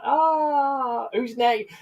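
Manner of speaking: put-on squeaky kid voice. Kids picking teams, acted voice.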